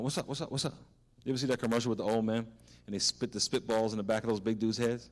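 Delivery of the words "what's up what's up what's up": trash-talking friend. Retold or imitated. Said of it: "and he went".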